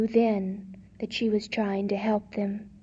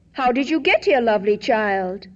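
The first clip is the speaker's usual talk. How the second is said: lightly voiced kind king; gentle king voice; 5 dB louder than usual. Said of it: "he asked".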